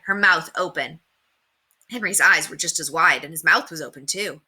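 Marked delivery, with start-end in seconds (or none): none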